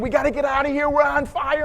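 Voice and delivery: high, loud acted shrieking; panicking wife. Wife struggles with the stuck car door, acted panic. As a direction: imitate a frantic wife yelling to escape. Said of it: "she's panicking".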